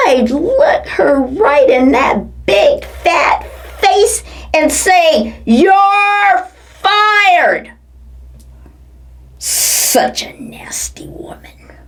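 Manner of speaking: normal talking voice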